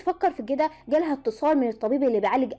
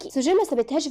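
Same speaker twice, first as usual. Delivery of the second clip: ordinary delivery, nothing marked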